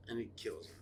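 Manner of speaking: ordinary talk, nothing marked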